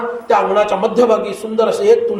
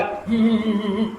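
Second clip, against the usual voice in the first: soft, repeated hm-hm grunts; wordless humming reply; 6 dB quieter, faster than usual. Retold or imitated. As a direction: imitate answering by humming mid-prayer. Praying master answers servant wordlessly.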